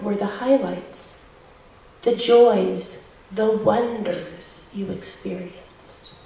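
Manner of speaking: slow, soft guided meditation voice; soft spa whisper. Acted. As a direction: ease them into calm reflection. Leads a guided reflection.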